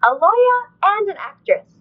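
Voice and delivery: put-on high, bright child's voice; little girl answer. Recalling her childhood answer to her parents, in a high childlike voice. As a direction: pipe it up like a proud little kid. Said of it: "And I said".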